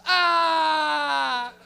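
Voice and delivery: loud, high mock yell "Ah!"; mocking shout. Bus heckler yelling at the loud lady, mock shout. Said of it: "One guy goes".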